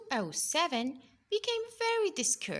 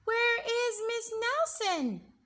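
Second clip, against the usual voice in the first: put-on high, louder children's question; worried kids; 12 semitones higher, 4 dB louder than usual. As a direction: ask like anxious kids. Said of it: "asked the kids".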